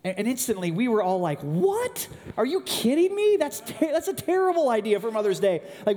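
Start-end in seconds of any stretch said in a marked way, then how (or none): 1.4-5.6 high, loud disbelieving yelp; incredulous outburst. Retold or imitated. Family at the kitchen table balking at the idea, incredulous and loud. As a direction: sons scoffing at mom's Mother's Day plan, high and loud in disbelief. Family objecting to mom's plan.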